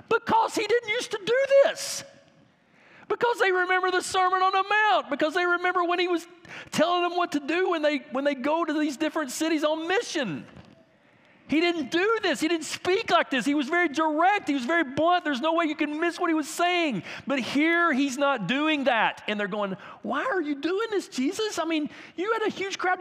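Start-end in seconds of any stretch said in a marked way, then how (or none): 0.1-19.2 high-pitched, insistent preacher; insistent preaching. Real, not acted. Preacher voices the disciples' reasoning. 20.0-23.0 high-pitched mock protest; incredulous disciples. Acts out disciples challenging Jesus.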